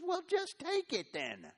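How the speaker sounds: high, slow, dismissive queen voice; scheming queen. Acted. Jezebel tells the king to just take the vineyard, high and slow.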